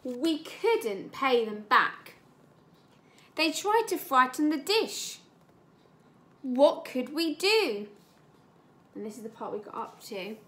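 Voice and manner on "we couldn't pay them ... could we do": ominous storybook read-aloud; story reading. Acted. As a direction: build a little suspense for the children. Teacher reads story aloud.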